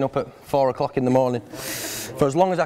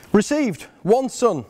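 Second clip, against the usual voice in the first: raised, slow mock formal reading; reading out a mock contract; 6 semitones higher, slower than usual. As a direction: read it like a sales receipt. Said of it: "It reads".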